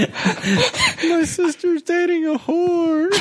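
high slow words through laughter; laughing. Real, not acted. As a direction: squeal it while cracking up. Cracking up mid-sentence.

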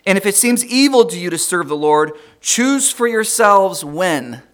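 loud, slow proclamation; exclamation point. Acted. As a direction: thunder out the command slowly. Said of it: "he says with an exclamation point".

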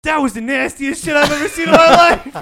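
high, loud disgusted exclaim; mock horror, way up high; imitating a grossed-out sergeant.

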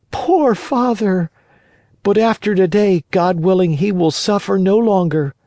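high-pitched puppet lamenting his father; high childlike pity, then resolve; pitying puppet boy. Acted. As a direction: pitch it high, full of earnest concern. Voices Pinocchio pitying his father.

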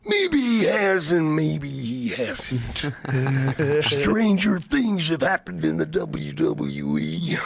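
slow, stretched-out wrestler promo; Macho Man. Retold or imitated. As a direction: draw every phrase out like a wrestler cutting a promo. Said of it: "That's a great Macho Man impression".

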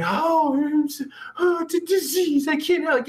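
high mock-helpless voice; mock weakling whining. Host mocking people who call drinking a disease, whiny mimicry. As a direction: imitate a weakling making excuses. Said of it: "these fucking weak motherfuckers".

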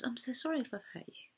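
soft, quick apology; acted gentle apology; apologetic. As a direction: say sorry gently, voice dropped. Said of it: "So apologise".

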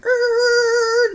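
long high-pitched imitated car skid; high tire screech. Car nearly hit spinning out, imitated long high screech. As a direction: screech like skidding tires.

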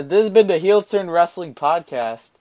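fake podcast outro. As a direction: announce the show's end like a host. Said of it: "No, I'm kidding".

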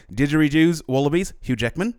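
put-on high, loud Australian accent. Reciting a mantra to get back into an Australian accent. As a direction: chant it in broad Australian. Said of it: "to get back in Australian accent".

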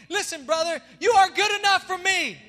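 loud, rising preacher's call to the crowd; full-force preaching. Acted. Pastor preaching forgiveness to his church, loud and fervent. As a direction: preach it out to the congregation.

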